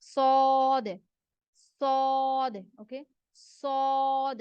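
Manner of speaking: teacher repeats heavy "सौद"; heavy letter demo. Shown as a demonstration. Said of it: "मोटा करके अदा करेंगे".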